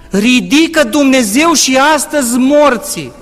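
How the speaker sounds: fiery preacher's declaration; preaching at full force. Acted. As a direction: proclaim it to the whole congregation. Preaching climax in church.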